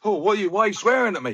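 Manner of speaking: high, fast, hammed-up offended whine; mock whiny youngster. Mocking touchy young footballers.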